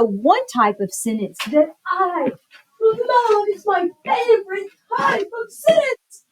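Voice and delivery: high, loud gushing over a favorite; excited exclamation. Shown as a demonstration. Teacher showing the class an exclamatory sentence, excited exclamation. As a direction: exclaim it at full pitch, drawn-out emphasis.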